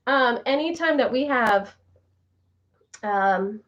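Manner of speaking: usual speaking voice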